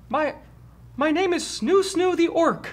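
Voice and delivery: very high, slow, timid introduction; un-orclike orc voice. Acted. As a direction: squeak it out hesitantly from inside a cell. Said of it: "it doesn't sound like an orc".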